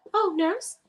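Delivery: high, weak plea for a nurse; calling feebly for the nurse; quoting a movie line. Retold or imitated.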